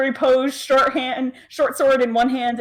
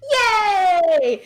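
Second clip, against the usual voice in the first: excited shout; 7 semitones higher than usual. Real, not acted. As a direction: yell it with joy. Cheering over loot.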